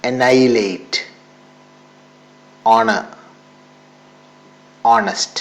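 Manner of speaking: honor said "onor", with no h; Annihilate, honor and honest said without the h; silent h. Shown as a demonstration. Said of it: "എച്ച് silent ആണ്". (എച്ച് ഉച്ചരിക്കാതെ)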